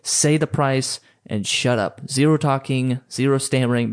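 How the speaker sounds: normal talking voice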